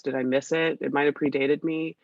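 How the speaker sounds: ordinary delivery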